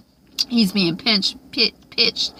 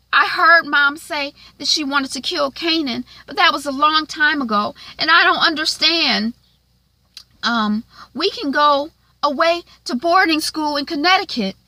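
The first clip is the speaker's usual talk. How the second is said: put-on higher, louder young girl voice, anxious; a kid sister's fretful, higher voice; worried sister; 10 semitones higher, 3 dB louder than usual. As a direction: play the worried little sister checking on her brother. Sister voiced, pleading with brother.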